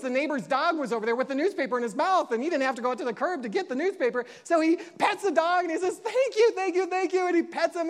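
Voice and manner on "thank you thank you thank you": much higher, gushing thank-yous; excited thanks. Retold or imitated. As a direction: bubble over with delight at the dog. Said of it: "he's so excited".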